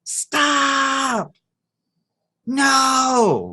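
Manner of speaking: high, drawn-out alarmed shout; acted panicked scream; mother's yell. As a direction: yell like a mom stopping her child from across the park. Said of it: "and yells".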